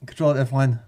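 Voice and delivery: ordinary talk, nothing marked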